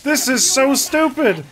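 loud, slow, high complaint; fed-up gamer outburst; exasperated. Real, not acted. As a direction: drag it out in loud frustration. Exasperated at endless boss fight.

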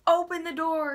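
mock stranger demand. Stranger outside calling up to the girls, imitated demand. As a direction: call up at the window like the stranger.

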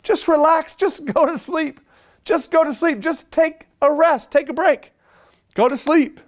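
put-on begging the kid in the back seat to sleep; exasperated parent. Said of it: "you're going".

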